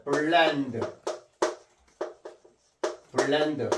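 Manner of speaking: 블렌드 with a clear L, tongue on the gum ridge; precise L. Shown as a demonstration. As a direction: model "블렌드" as b-llend, L fully touched. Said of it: "정확히 적으면 이렇게 합니다".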